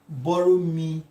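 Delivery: ordinary delivery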